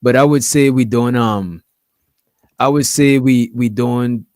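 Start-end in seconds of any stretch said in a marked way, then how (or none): none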